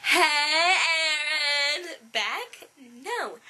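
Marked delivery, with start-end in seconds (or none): 0.0-1.9 loud, raised, stretched-out greeting; drawn-out call. Retold or imitated. Girl imitating a guy who always responds, drawn-out call. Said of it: "he did not go, Hey".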